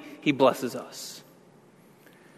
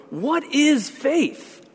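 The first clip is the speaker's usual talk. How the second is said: much higher and very slow, stretching each word; high, very slow emphatic question to the congregation; high drawn-out question; 10 semitones higher, much slower than usual. Shown as a demonstration. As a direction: lift it high and draw it out for effect. Preacher repeats key question.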